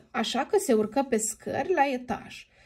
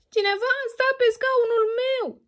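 higher little cub voice; baby bear; 12 semitones higher, 4 dB louder than usual. Acted. Baby bear notices his chair was sat in, high cub voice. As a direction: play the small cub calling out up high. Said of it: "strigă copilul urs".

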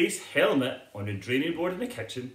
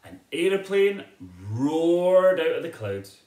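roaring narration; 4 semitones higher, 7 dB louder than usual. A narrator's reading. Narrator booming the aeroplane out of the clouds. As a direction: make the plane roar past.

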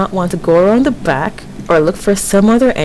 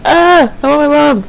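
high, frightened plea; scared whimper; 5 semitones higher than usual. Real, not acted. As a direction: cry out for mom in fear. Scared while exploring a horror game.

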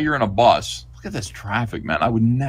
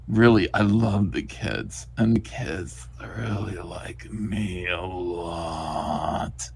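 slow, trailing creep voice; lingering hammed-up creepiness; creepy pervert; 4 semitones lower, 6 dB quieter, much slower than usual. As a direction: draw it out slowly, sinking low and soft. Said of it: "This is a pervert and a pedophile".

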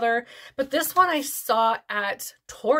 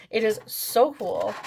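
usual speaking voice

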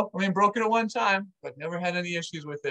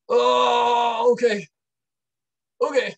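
louder, higher nervous okays; nervous bracing; 5 semitones higher, 7 dB louder, much slower than usual. Retold or imitated. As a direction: psych yourself up out loud before the pain. Bracing before nose gets set.

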